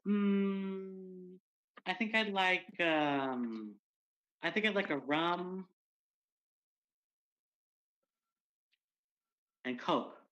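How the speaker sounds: dreamy, hesitant big bear; polar bear voice. Retold or imitated.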